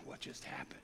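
whispered in disbelief; stunned whisper. Retold or imitated. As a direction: breathe it out, dumbstruck. Stunned enemies watching ascension.

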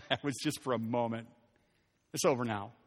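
low, soft, relieved chuckle; soft relieved laugh, low; laughing softly. Retold or imitated. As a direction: laugh it off as past. Looking back on hardship.

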